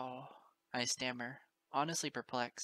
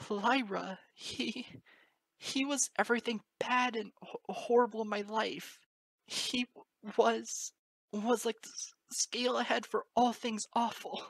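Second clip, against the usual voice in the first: higher, louder, stammering through tears; sobbing; 7 semitones higher, 5 dB louder than usual. Acted. Moon Dancer telling Lyra about her father, sobbing. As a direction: choke the words between sobs.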